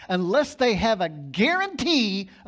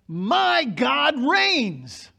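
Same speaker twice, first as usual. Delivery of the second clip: each word very slow and weighty, "my God reigns" drawn out; slow declaration; 7 semitones higher, 7 dB louder, much slower than usual. Acted. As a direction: land it slowly like a proclamation. Climactic sermon declaration.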